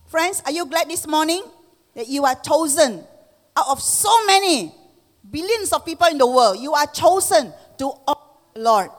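high, loud fervent preaching. Acted. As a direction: rouse the congregation. Preaching at full force.

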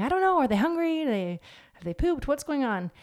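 high, flustered new-parent panic; mock panic. Worried parent over a crying baby, mock panic. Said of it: "you might freak out going".

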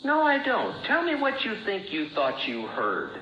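high sharp denial, then cutting; mock-wounded, cutting sarcasm; sarcastic sting. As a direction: throw it back at her, sneering. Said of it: "sarcastic routine".

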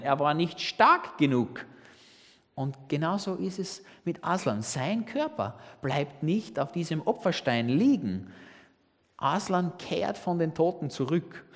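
normal talking voice

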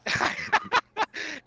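high, brief burst of laughter. Real, not acted. Caller teased by host about a warrant, high laugh.

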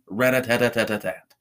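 rattling toy machine gun; kid's pretend machine-gun fire; machine-gun play noise. A narrator's reading. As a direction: spit out a child's rat-a-tat. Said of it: "pretending to get in high-speed car chases".

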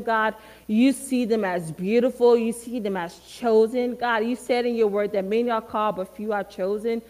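earnest spoken prayer to God; fervent prayer. Acted. Speaker praying for the students, earnest and fervent. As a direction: pray it out over the students.

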